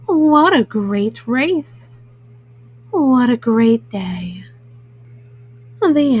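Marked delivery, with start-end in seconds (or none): none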